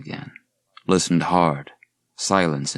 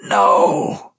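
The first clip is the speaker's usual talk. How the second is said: high, loud breathy cry; howl of pain; 5 dB louder than usual. Acted. Wounded man howls no at the creature. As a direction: howl it out in agony. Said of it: "Garrett howled in pain".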